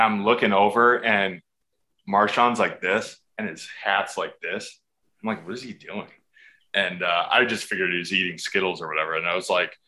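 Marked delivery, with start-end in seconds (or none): 5.4-6.1 much softer, half to himself; puzzled aside. Retold or imitated. Player on sideline noticing Marshawn's music, soft puzzled aside. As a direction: wonder it under your breath.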